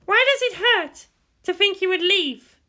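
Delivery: put-on a proud girl cursing to herself; teenage girl's inner voice. Pilot girl fuming inwardly over the boy.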